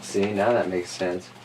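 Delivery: plain reading voice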